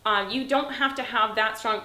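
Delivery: normal talking voice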